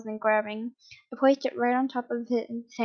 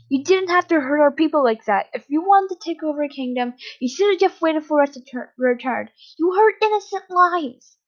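high, louder, impassioned reproach; outraged protest; 5 semitones higher, 5 dB louder than usual. Acted. Hizashi berating captor over hurt people, outraged. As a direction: protest loudly, pitch climbing on the accusation.

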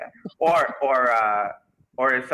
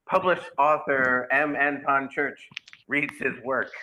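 mock announcement; 6 semitones higher than usual. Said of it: "I'll have to advertise it as".

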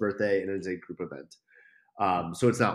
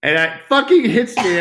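much higher and louder, blurted out in shock; sudden realization; 13 semitones higher, 15 dB louder than usual. Real, not acted. Telling friends the date twist hit him, shocked outburst. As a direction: burst out as it all clicks.

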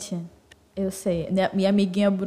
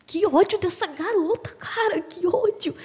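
high-pitched furious complaint; angry; 9 semitones higher than usual. Real, not acted. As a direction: vent real anger. Angry rant about a housemate.

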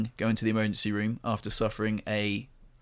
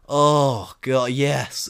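drawn-out, louder moan of relief; relieved; 6 dB louder, much slower than usual. Retold or imitated. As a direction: re-enact blissful relief. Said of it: "I could feel the relief".

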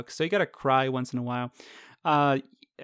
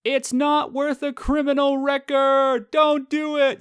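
much higher, louder, slow pleading passerby; 11 semitones higher, 6 dB louder, slower than usual. Retold or imitated. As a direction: beg strangers to stop a brawl. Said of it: "the biggest line that me and my wife heard".

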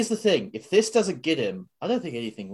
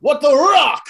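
The Rock impression; 12 semitones higher, 10 dB louder, slower than usual. Riffing on Rock catchphrase.

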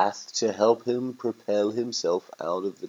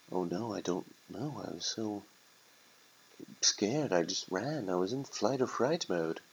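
soft, hesitant, mildly fretful turkey; lightly voiced timid bird; scared turkey; 7 dB quieter than usual. Turkey admitting it fled in fright, softly distressed.

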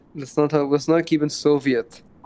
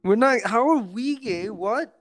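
higher, mock outraged denial; indignant; 5 semitones higher than usual. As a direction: protest in fake shock.

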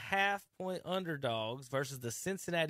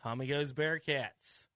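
ordinary delivery

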